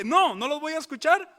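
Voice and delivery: loud, high, firm refusal in God's voice; the preacher voices God turning away the people, loud and high; God refusing. Acted. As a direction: slam the door on them.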